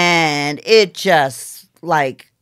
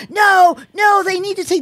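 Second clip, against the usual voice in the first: mock shout; 13 semitones higher, 6 dB louder than usual. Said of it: "they were like".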